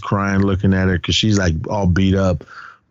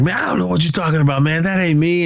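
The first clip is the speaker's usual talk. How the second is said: higher-pitched, quick mock denial; retold defensive protest; 8 semitones higher than usual. Student denying blame to the principal, mock defensive. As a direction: protest your innocence, pitched up.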